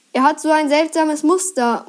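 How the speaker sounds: usual speaking voice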